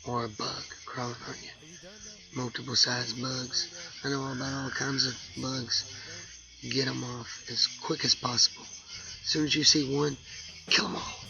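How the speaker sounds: hushed, grave whispering throughout; somber whisper. Acted. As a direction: whisper it like a solemn warning. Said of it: "This is a somber public service announcement".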